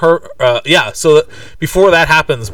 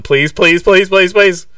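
mild mock begging, rising in pitch; pleading; 6 semitones higher, 4 dB louder, slower than usual. Imitating dad phoning the Murphys, mildly pleading. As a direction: plead in a quick chain, lightly acted.

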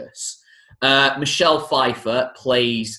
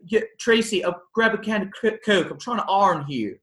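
lightly put-on higher Baltimore accent as Edna; a hint of Edna's Baltimore accent, raised pitch; 7 semitones higher than usual. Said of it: "thinking with his accent".

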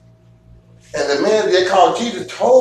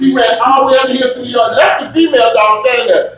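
excited; 6 semitones higher, 4 dB louder than usual. Real, not acted. Excited dog fight story.

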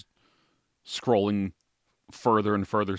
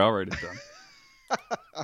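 laughing; 4 dB quieter, much slower than usual. Real, not acted. Joking promise while laughing.

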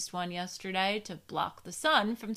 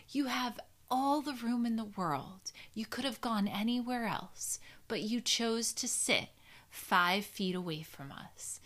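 whispering; 7 dB quieter than usual. Real, not acted. Whispered complaint about the family.

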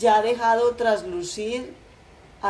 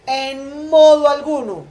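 defiant refusal; 6 semitones higher, 10 dB louder than usual. A narrator's reading. Son defies father in quarrel.

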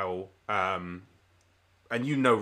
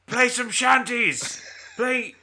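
high, loud, drawn-out crowd heckle; heckling shout; 13 semitones higher, 6 dB louder, much slower than usual. Acted. Tavern patron heckling the bard on stage, shouting. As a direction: yell it at the performer. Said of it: "patrons kind of heckle you".

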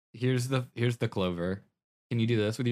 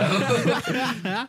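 high burst of laughter; loud laugh; 5 dB louder than usual. Real, not acted. Laughing at mistake.

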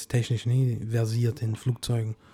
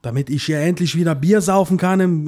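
Kinski rant; 4 semitones higher, 5 dB louder than usual. Pretend. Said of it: "So wird er wahrscheinlich jetzt abgehen".

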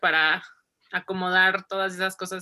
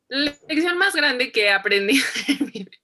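higher, louder, breaking into giggles; laughing; 5 semitones higher, 5 dB louder than usual. Real, not acted. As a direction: crack up while repeating the question. Cracks up at her pageant answer.

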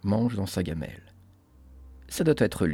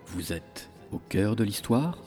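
horror host; 5 dB quieter than usual. Acted. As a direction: welcome them into the crypt, ominously. Horror podcast host intro.

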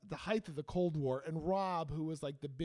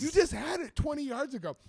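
incredulous protest; 8 semitones higher, 6 dB louder than usual. Retold or imitated. Acted protest to friend who lost passport.

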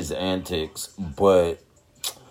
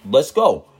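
hype shout; 6 semitones higher, 4 dB louder than usual. Real, not acted. Hype podcast sign-on.

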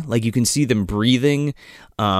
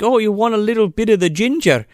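higher, quick mock-Scottish offer; 6 semitones higher, faster than usual. Dad offering his kid a drink, mock Scottish accent. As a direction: offer it in a hammy Scottish lilt. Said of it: "the fucking Scottish shit again".

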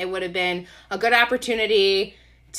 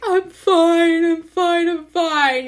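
high, loud wailed insistence; imitated sobbing; 9 semitones higher, 5 dB louder, slower than usual. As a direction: act out insisting through your sobs. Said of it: "you know, through my sobbing".

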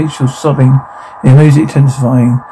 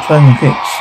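normal talking voice

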